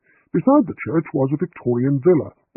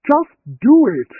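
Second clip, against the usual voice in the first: slow, much higher and louder exasperated shout; 12 semitones higher, 8 dB louder than usual. A narrator's reading. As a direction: lose patience and yell it. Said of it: "shouted the vicar".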